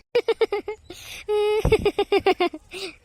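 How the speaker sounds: long, high-pitched giggling fit; prolonged acted laughter; teasing laugh. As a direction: burst out laughing at her answer. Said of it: "ஏய், எதுக்கு சிரிக்கிற?".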